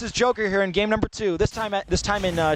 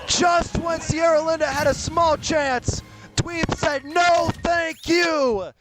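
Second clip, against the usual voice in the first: hyped commentary; 5 semitones higher, much slower than usual. Real, not acted. Hyped play-by-play after the clutch.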